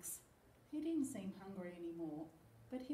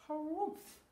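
loud, higher walrus harrumph; acted animal grunt, pitched up; wheezing walrus; 7 semitones higher, 6 dB louder than usual. A narrator's reading. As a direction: harrumph loudly like a poorly walrus. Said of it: "wheezed Walrus".

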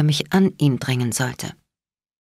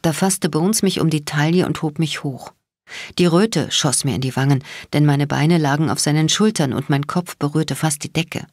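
plain reading voice

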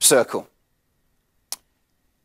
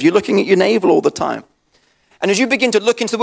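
fervent preaching; 7 semitones higher than usual. Acted. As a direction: press the point to the congregation. Rising preaching fervour.

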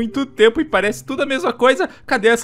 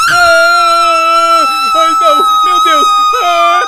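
a long, very loud, high screaming; falling screams; 10 dB louder, much slower than usual. Acted. Steve keeps falling down the cliff, loud panicked screaming.

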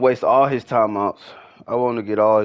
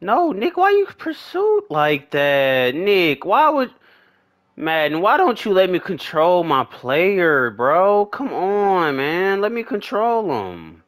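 high-pitched whining protest; exasperated pleading; 7 semitones higher than usual. Real, not acted. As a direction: beg the game in frustrated disbelief. Pleading with game over player control.

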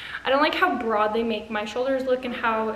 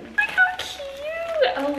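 very high, slow, delighted coo; high drawn-out squeal of delight; gushing squeal; much slower than usual. Real, not acted. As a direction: melt over how cute it is. Gushing over the gift.

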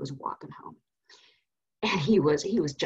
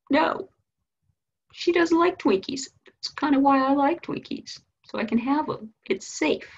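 put-on higher-pitched, childlike boy voice; upset little boy; 6 semitones higher than usual. Boy explaining Twinkies to his mom, childlike. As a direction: pitch it up like a kid justifying himself.

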